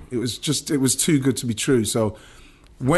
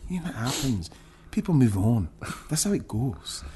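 mock Scottish accent, softer; 4 dB quieter than usual. The boss consoling a crying player, in a Scottish accent. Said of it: "he's doing his stuff with his Scottish accent".

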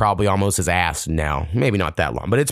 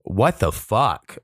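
slow disbelief; much slower than usual. Retold or imitated. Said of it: "and I'm like".

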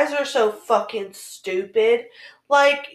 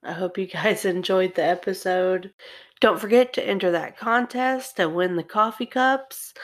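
calm, lower sign-off read; podcast outro; 5 semitones lower than usual. Acted.

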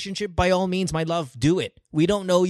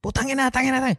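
lightly acted boyfriend swearing, pitched up; boyfriend's cursing; 8 semitones higher, faster than usual. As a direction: curse in higher pitch, playing her boyfriend. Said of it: "I probably represent her boyfriend right now".

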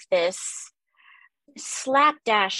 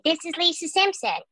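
a bit higher, little-girl cartoon voice; Lisa Simpson voice; 8 semitones higher than usual. Shown as a demonstration. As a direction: lift into a young girl's voice. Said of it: "I'll just go a little higher than my natural voice".

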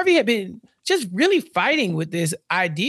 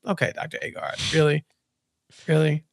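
sarcastic disbelief; 5 semitones lower, 3 dB quieter than usual. Retold or imitated. Mocking reply to doctor.

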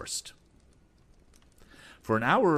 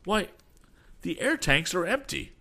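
high-pitched shocked discovery; sudden alarm, pitch jumping up; alarmed cry; 11 semitones higher, 4 dB louder than usual. A narrator's reading. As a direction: cry out the bad news. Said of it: "the young inventor cried".

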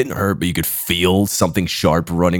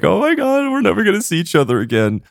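mock sobbing friends; 11 semitones higher than usual. Friends crying at prom.

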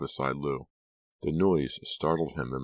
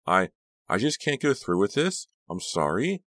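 distraught; 6 semitones higher, 5 dB louder than usual. A narrator's reading. Ava breaking down.

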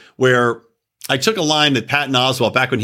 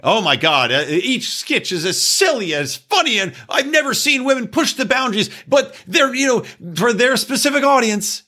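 mock gushing critic; 8 semitones higher than usual. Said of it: "they're all raving".

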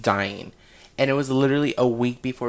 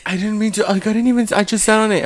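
a hint of a higher, defensive whine; mock-innocent friend; 8 semitones higher than usual. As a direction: play the guilty friend swearing it wasn't him. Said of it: "He's like".

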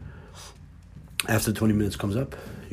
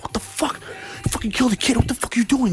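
much higher, shocked disbelief; shocked outburst; 13 semitones higher than usual. Retold or imitated. Babysitter reacts to the kid's killing in shock. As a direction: shriek at the kid in horror. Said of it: "And you're like".

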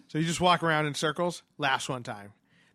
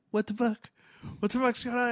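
higher, alarmed disbelief; retelling a door slamming shut in the game, startled; 9 semitones higher than usual. Retold or imitated. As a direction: act out being spooked in the game. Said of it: "You're like".

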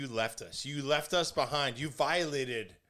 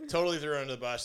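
ordinary talk, nothing marked

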